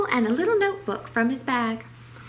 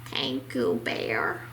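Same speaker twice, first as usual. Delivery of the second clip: sniffled; 9 semitones lower, 4 dB quieter, slower than usual. A narrator's reading. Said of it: "Mouse sniffled".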